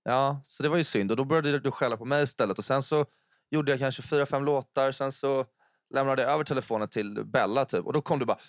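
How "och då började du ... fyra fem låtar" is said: ordinary talk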